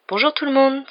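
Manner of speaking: high, loud, put-on brightness; feigned cheer. A narrator's reading. Mother greeting the kitchen with forced cheer. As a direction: cover your worry with a bright hello. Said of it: "s'exclama-t-elle avec une gaieté feinte".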